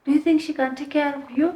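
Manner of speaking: high, indignant mock question; retold angry yelling. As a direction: imitate her challenging someone. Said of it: "she started yelling at me".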